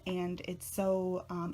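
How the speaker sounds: ordinary talk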